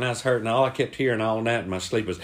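usual speaking voice

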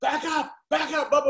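man yelling at the neighbour to back off; crazy yell. Retold or imitated. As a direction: yell it like a madman. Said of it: "I start going, like a crazy".

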